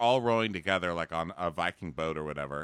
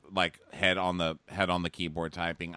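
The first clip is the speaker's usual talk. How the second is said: ordinary talk, nothing marked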